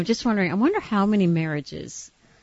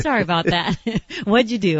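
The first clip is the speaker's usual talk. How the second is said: real laughter through the words; giggly, amused curiosity; laughing apology; 4 semitones higher, 3 dB louder than usual. Real, not acted. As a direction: apologize while still cracking up. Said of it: "I'm sorry, I shouldn't laugh".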